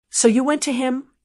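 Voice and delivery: usual speaking voice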